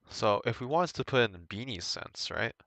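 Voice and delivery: normal talking voice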